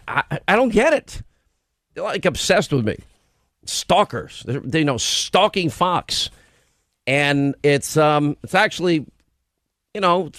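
ordinary delivery

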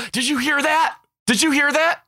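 high, urgent "Did you hear that?" imitating a kid; excited kid yell. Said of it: "one student would just yell".

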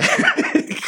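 unvoiced high laugh; real laugh; breathy high laugh. Real, not acted. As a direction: burst out in airy laughter. Laughing after mother's line.

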